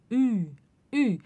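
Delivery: Ü with rounded lips while saying I; German ü sound. Shown as a demonstration. Teacher to students, showing the rounded Ü. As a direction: purse the lips and say Ü. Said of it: "Biquinho e falar I".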